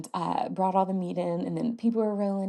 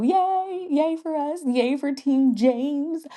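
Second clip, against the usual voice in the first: high, bright mock cheer, slowing down; 8 semitones higher, 3 dB louder, much slower than usual. Real, not acted. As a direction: cheer for the team. Cheering the baby news.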